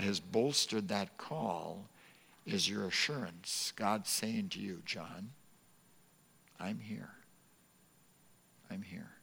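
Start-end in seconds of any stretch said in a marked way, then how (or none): 4.9-9.2 soft and hushed; tender reassurance. Retold or imitated. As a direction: speak it gently as a promise. God's voice to John, tender.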